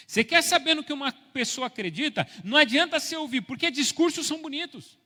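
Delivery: loud, high, driving preacher's delivery; impassioned pulpit shout, pressing on phrase after phrase; full-force preaching. Real, not acted. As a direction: exhort the congregation at full voice.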